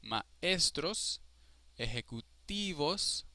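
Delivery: Maestros and ejecutivos with stretched ES and TI; stress on second-to-last. Shown as a demonstration. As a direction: lean hard on maES-tros, ejecuTI-vos. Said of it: "the second to last syllable is strongest".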